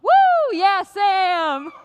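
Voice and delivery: high, loud whoop of support; cheering. Real, not acted. Host cheers as Sam takes the stage, whooping. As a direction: cheer for your friend.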